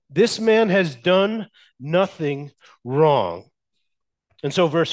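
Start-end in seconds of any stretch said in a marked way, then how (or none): 0.1-3.5 grave slow declaration; slow emphasis. Shown as a demonstration. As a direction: lean on "man" and drag it out. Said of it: "slow emphatic repeat".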